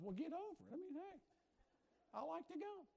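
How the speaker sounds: high, cocky brush-off voice; mock defiant retort. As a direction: toss it off like a stubborn husband. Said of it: "my response initially was".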